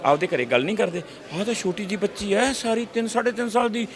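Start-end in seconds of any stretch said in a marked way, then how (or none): 1.3-3.9 put-on high-pitched marvelling at a child; acted high-voiced wonder; amazed onlooker. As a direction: sound astonished by a toddler's cleverness. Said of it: "ਹੈਰਾਨ ਨਹੀਂ ਹੁੰਦੇ".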